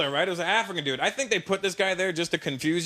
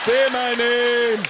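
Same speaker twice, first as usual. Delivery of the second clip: loud, high, long drawn-out demand; hammed-up climax; mock passionate cry; 5 semitones higher, 6 dB louder, much slower than usual. Imagined climax of lovemaking.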